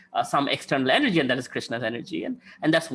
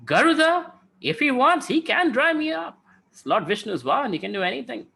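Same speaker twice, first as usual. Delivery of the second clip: high, anxious ocean voice pitched way up; nervous ocean; 7 semitones higher than usual. Acted. Ocean warning sparrow about Garuda, nervous and high. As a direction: panicky warning, voice jumping up high. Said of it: "the ocean got a little nervous".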